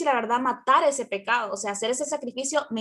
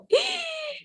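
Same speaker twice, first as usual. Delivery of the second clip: mock gasp. Caught out by daughter's remark.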